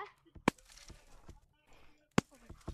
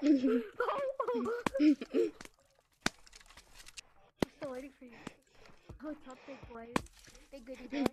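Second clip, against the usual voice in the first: tattling sibling; 3 semitones lower, 7 dB louder, faster than usual. Acted. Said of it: "literally sound like Tattletale".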